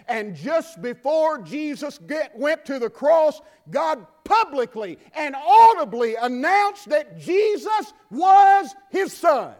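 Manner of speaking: preacher's rising shout; preaching climax. Acted. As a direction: build to a ringing shout.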